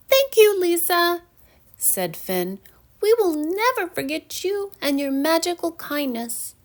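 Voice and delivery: a tiny fairy saying goodbye; grateful little fairy. A narrator's reading. Finn thanking Lisa, high grateful voice.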